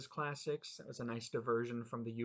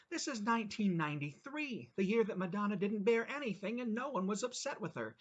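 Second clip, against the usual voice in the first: outraged tirade; 4 semitones higher than usual. Acted. Customer ranting about the sheriff, high and indignant. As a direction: go off on them, pitched up. Man's escalating tirade at cook.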